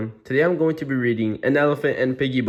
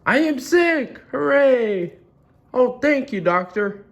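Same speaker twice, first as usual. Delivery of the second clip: very high, gleeful cheering; overjoyed; 4 dB louder than usual. Acted. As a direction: celebrate like it's wonderful news. Gerald joyful to learn he's sick.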